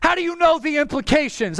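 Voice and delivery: usual speaking voice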